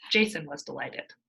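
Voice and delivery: ordinary delivery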